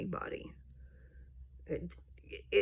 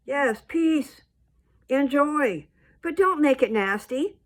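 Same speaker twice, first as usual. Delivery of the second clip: raised, louder indignant scolding; a preacher's angry rebuke at the screen; heated scolding; 7 semitones higher, 6 dB louder than usual. Real, not acted. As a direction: tell them off, fed up and loud. Lecturing people about pot posts.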